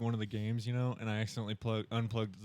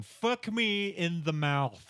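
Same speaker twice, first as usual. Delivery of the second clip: drawn-out mock yell, pitched up; retold frustrated yell; 5 semitones higher, much slower than usual. Driver alone venting his pet phrase, mock frustrated yell. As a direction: groan it out slowly, imitating frustration. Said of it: "that I yell in frustration".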